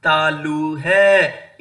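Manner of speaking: तालु है drawn out very slowly; slow emphasis. Shown as a demonstration. Teacher slowly states the palate answer. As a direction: stretch the answer word. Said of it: "उच्चारण स्थान".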